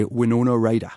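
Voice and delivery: Winona Ryder in a Geordie accent, laughing. Shown as a demonstration. A warm-up line to get into Geordie, light Geordie accent. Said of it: "Is you just say".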